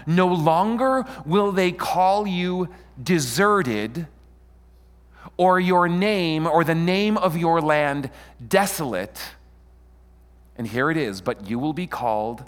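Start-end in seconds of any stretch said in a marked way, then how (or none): 0.1-9.4 slow, high, ringing declaration; scripture proclamation. Acted. Preacher reading Isaiah's promise to the congregation, slow raised proclamation. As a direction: proclaim each phrase grandly, drawn out.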